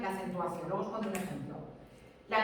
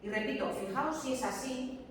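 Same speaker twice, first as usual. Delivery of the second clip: ordinary delivery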